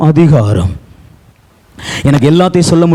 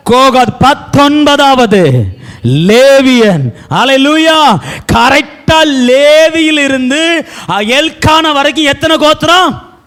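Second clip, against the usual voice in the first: raised pulpit voice; full-force preaching; 10 semitones higher than usual. Acted. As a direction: preach it high to the whole congregation.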